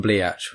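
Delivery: "Bleach" split into "blee-atch"; punning pronunciation. Shown as a demonstration. Recapping old nicknames for a friend, "Bleach" punned as "blee-atch". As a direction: pronounce "Bleach" with two syllables, as "blee-atch". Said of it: "instead of Beach and Bleach".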